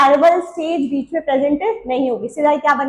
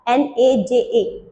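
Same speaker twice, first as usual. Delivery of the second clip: very slow letter-by-letter spelling of एन ए जे ए; much slower than usual. Shown as a demonstration. Teacher spelling the snake name, slow and careful. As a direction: say एन, ए, जे, ए one letter at a time. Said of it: "नाजा नाजा".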